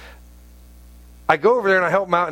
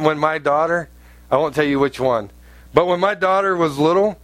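ordinary talk, nothing marked

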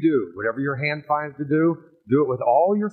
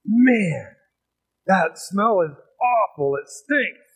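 disgusted; 5 semitones higher, much slower than usual. Retold or imitated. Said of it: "he's like".

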